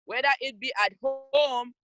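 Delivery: ordinary talk, nothing marked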